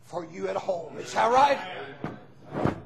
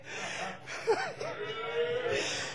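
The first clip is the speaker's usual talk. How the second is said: joyful shouting laugh; 14 semitones higher, 8 dB quieter than usual. Real, not acted. Preacher's joyful outburst.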